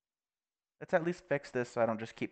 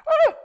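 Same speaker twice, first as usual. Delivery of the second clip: short fright squeal, cut off fast; squeal, then clamp it down; stifled squeal; 13 dB louder than usual. Real, not acted. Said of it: "I'm trying not to scream".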